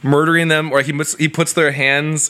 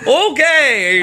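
high chipper okay; 10 semitones higher, 3 dB louder than usual. Pretend. Rabbi agreeing from the hole.

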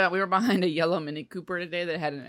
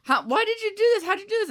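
higher voice, lightly voiced bewildered mother; a hint of a confused mom; 12 semitones higher than usual. Retelling the mom's reaction to a new car, lightly higher bewildered voice. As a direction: mimic a baffled mom asking why. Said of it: "the mom was like".

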